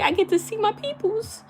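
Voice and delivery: high, gleeful cry of delight; giddy high-pitched thrill; excited. Retold or imitated. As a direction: squeal with joy at the parade. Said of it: "I'm like".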